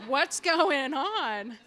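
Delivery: seeing her sister hugged instead of punched, astonished; surprised. Retold or imitated. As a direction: stretch it out in disbelief. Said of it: "I was like".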